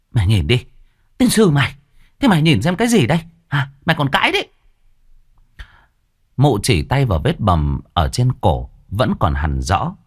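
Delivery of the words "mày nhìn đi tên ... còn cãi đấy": scolding mother. A narrator's reading. Mother shows bruise, scolds.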